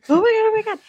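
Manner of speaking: high panicked "oh my God"; mock panic. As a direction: act out the rising panic. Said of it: "yeah, I was".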